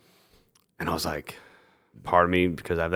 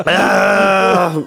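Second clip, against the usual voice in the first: sour gasp; 12 semitones higher, 8 dB louder than usual. Real, not acted. Sour beer sip.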